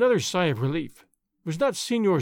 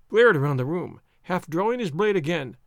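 usual speaking voice

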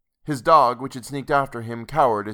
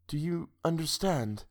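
hushed, slightly higher question; soft whisper; 6 semitones higher, 7 dB quieter than usual. A narrator's reading. Woman asks the man if he understands, softly whispered. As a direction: ask it softly, almost whispering. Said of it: "She whispered".

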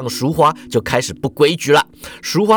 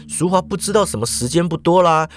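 ordinary delivery, nothing marked

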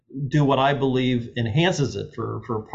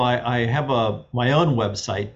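ordinary talk

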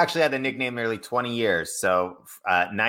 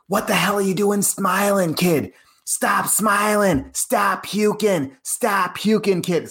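much higher, slow, barking Boston coach; Boston accent; 12 semitones higher, 3 dB louder, slower than usual. Retold or imitated. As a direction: yell at the kid like a hard-nosed Boston coach. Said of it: "you were using the Boston accent".

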